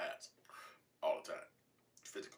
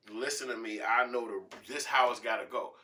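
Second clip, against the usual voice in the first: loud, high, commanding; forceful lecture; 5 semitones higher, 12 dB louder than usual. Acted. Dad laying down house rules to kid, loud forceful lecture. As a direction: lay down the law at volume.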